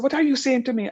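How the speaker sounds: high, offended comeback; mother bristling at her daughter's advice; indignant mother. Retold or imitated. Sick mother in bed retorting to her daughter, indignant. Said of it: "And she said".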